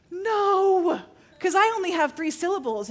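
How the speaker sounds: high, loud cry of dread; mock panic. Told to pray aloud in tongues, mock panicked. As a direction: protest as if about to be exposed.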